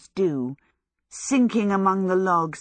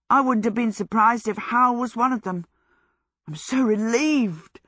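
higher, gushing, lightly voiced joy; happy relief; 5 semitones higher, 3 dB louder than usual. A narrator's reading. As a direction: let the relief spill out. Michael jubilant about being loved.

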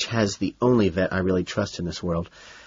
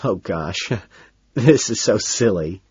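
embarrassed chuckle through the words; sheepish, giggly confession; chuckling. A narrator's reading. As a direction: admit it with a bashful little laugh. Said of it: "he admits, chuckling".

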